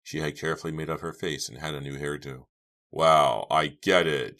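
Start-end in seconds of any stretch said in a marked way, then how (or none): 2.9-4.4 loud, punchy realization; forceful exclamation of discovery; declaring. A narrator's reading. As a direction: announce it as it dawns on you. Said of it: "Chuck declared".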